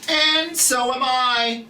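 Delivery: high, slow little mouse squeak. A narrator's reading. Montmorency the mouse agreeing with Fang, a high slow squeak.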